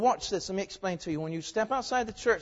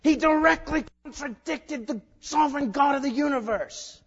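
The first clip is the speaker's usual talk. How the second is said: indignant, higher and louder; irritated; 9 semitones higher, 7 dB louder than usual. Real, not acted. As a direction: let the irritation show. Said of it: "Sounds like I'm a little irritated".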